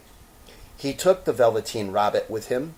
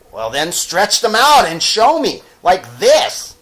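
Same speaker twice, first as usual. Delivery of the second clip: high, loud demand; challenging wild rabbit; 9 semitones higher, 12 dB louder than usual. Acted. Wild rabbit daring the toy to show his legs, loud. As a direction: dare him to prove it.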